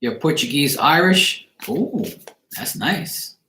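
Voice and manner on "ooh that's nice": ordinary delivery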